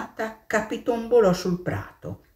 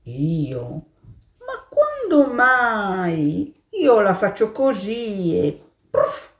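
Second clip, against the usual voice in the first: higher, slow pig voice; hammed-up pig denying it; 5 semitones higher, slower than usual. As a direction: scoff at the idea. Pig answers the mole.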